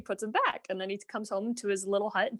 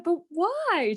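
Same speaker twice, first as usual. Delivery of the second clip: high, plaintive "but why"; the meek husband objecting to his wife, high and plaintive; whiny husband; 9 semitones higher, 4 dB louder than usual. Retold or imitated. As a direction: pitch up into a protesting whine. Husband protests in tale.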